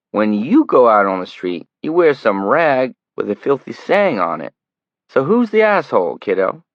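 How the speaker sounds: mock him with each word; taunting. Greg taunting and threatening the kid.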